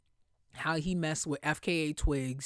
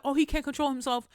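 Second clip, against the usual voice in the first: mocking excuse; 9 semitones higher, 4 dB louder, faster than usual. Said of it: "they were like".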